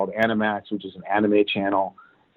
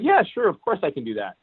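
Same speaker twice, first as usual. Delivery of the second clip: bright, higher, breezy yes, hiding doubt; breezy confident yes; 8 semitones higher than usual. Retold or imitated. To his Sony bosses, a breezy overconfident yes. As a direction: toss off the yes like it's easy.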